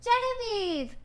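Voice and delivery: put-on high boyish cry of a name; child calling. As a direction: call out as a small boy. Narrator voices Harold calling sister.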